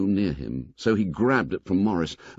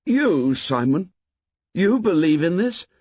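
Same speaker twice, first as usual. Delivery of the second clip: amazed; 8 semitones higher, 4 dB louder than usual. A narrator's reading. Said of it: "in amazement".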